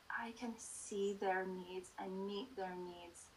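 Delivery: slow soft whisper. Real, not acted. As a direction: breathe it out gently. Tender close of a thought.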